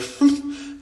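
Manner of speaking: small high laugh; short chuckle. Real, not acted. Joking about being there to babysit, small laugh. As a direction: chuckle at yourself.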